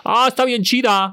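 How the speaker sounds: high, mocking teen slang voice; imitating her generation. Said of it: "¿Cómo hablaba?". (imitando a una chava hablando, agudo y burlón)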